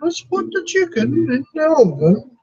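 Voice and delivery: imitating a TV chef's high warbling voice; Julia Child impression. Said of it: "she talked like this".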